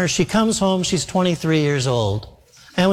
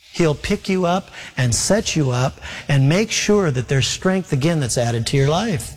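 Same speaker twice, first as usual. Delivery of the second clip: usual speaking voice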